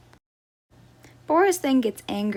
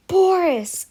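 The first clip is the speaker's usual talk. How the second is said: higher, louder call up to the tree; 7 semitones higher, 5 dB louder than usual. Acted. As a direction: call his name up from below. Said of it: "called one of the guests".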